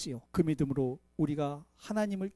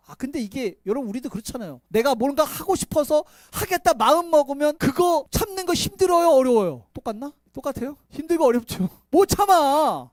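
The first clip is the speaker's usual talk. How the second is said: usual speaking voice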